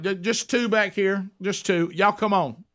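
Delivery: high-pitched call to waiting diners; imitated table call. Acts calling the party to table.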